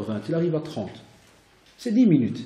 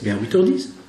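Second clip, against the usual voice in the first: usual speaking voice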